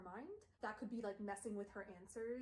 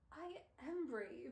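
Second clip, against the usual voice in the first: slow, lightly voiced drowsy insistence; a hint of a drugged hero; dopey drugged voice; 4 semitones higher, much slower than usual. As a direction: drawl it out half out of it. Drugged Will insists he's brave.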